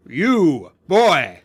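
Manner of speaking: loud call, rising in force. Acted. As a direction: shout to single out one boy. Knight calls boy from crowd.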